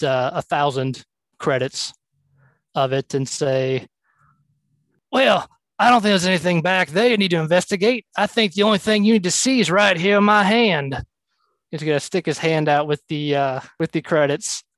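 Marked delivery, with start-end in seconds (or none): 5.1-11.0 higher, quick in-character voice; higher, lively bribing patter; smooth-talking player character. Acted. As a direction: talk the officer out of searching. Player voices his character to officer.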